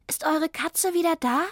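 put-on a kid calling at the door; lightly voiced child; child's voice. Child in boots asks.

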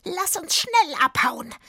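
high, loud, croaky witch; croaking witch. A narrator's reading. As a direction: urge it on in a croaking hag's voice. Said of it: "mit ihrer rauchig krächzenden Stimme".

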